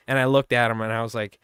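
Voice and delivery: ordinary talk, nothing marked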